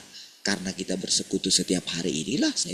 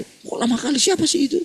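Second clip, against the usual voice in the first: high, louder mock wife asking suspiciously; imitating the wife; 5 dB louder than usual. Preacher voices his wife asking who is calling, high mock suspicion. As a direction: pitch it up like a jealous wife. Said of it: "sampai istri saya".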